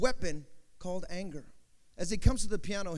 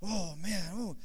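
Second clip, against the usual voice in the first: psyching himself up; 2 semitones lower, 7 dB quieter, faster than usual. Acted. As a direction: talk yourself into it under your breath. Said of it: "Starts building it up".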